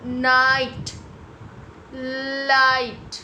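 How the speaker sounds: नाइट, लाइट loud with stretched "aai"; long I sound. Shown as a demonstration. Teacher showing silent GH, long loud नाइट, लाइट. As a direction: punch and hold the vowel in नाइट, लाइट. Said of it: "सिर्फ long sound होगा".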